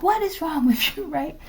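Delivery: playing the guy scolding the Russian, high and indignant; high-pitched outraged guy. Teller imitates the scolded guy, high and indignant. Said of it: "the guy was like".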